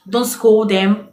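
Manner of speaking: ordinary delivery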